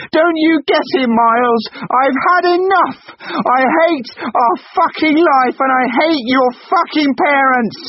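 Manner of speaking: very high, loud enraged scream; screaming rage. Acted. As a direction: let all the hatred out. Wife screaming in quarrel.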